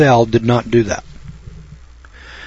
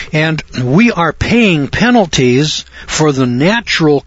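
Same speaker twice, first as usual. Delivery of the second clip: raised, higher preaching voice; fiery preaching; 3 semitones higher than usual. Acted. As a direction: warn the congregation of the coming day. Preaching at full force.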